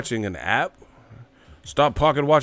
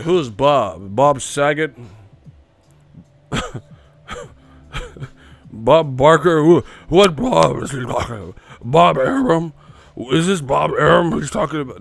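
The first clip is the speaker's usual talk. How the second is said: loud confused mock questions; bewildered guessing names; confused fans; 3 semitones higher, 7 dB louder than usual. Mocking fans who don't get the joke, loud. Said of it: "They're like".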